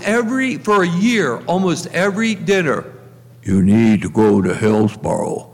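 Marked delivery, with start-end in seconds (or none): 3.4-5.5 low, booming imitation of a tall priest; deep voice of Father Stan. Priest imitating his friend over dinner, low and deep. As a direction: drop way down into a big priest's voice. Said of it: "in that deep voice".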